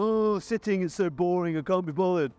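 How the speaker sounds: mock whiny teen who can't be bothered; hammed-up teenage reluctance; bored teenage girl. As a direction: sigh it out like a half-hearted teenager. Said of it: "sixteen-year-old teenage girl".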